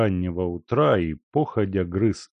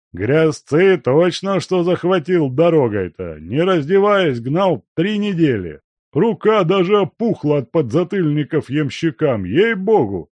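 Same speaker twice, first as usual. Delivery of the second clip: put-on loud, higher swaggering old man; boastful old miner; 9 semitones higher, 7 dB louder than usual. A narrator's reading. Guest boasting to the servant about the road, loud and swaggering. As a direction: brag about the hard ride.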